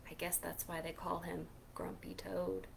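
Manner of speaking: soft unvoiced aside to the listeners; whispered aside. Reader whispering a joke to the kids, hushed.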